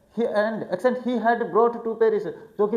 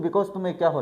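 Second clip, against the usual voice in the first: ordinary talk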